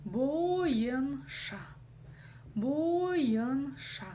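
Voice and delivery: «Боенша» медленно, по слогам; растяни «Бо-ен-ша», прочитывая каждую букву; каждая буква слышна. Shown as a demonstration. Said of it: "Каждая буква здесь должна прочитаться". («Боенша» протяжно, с отчётливым «й»)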